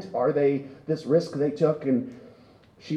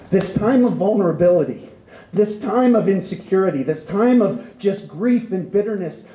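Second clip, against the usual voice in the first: rising, louder preacher's build; impassioned preaching; 6 semitones higher, 6 dB louder than usual. Acted. Pastor pressing the congregation on grief, in impassioned preaching. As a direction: press each "this time of" harder.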